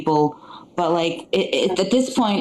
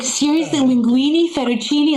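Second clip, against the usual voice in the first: higher, mock-disbelieving pasta list; incredulous; 6 semitones higher than usual. Wife mocking father-in-law's Italian packet, incredulous. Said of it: "I was like".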